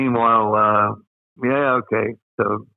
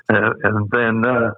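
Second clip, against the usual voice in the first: ordinary talk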